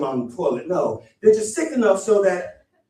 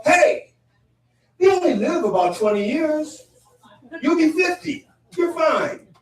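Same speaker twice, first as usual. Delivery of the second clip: high, hammed-up friend voice; breezy mock reassurance, pitched way up; upbeat friend; 12 semitones higher than usual. Friends approving a pet at thirty, exaggeratedly upbeat.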